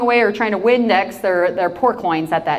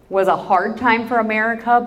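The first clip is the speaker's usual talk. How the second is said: usual speaking voice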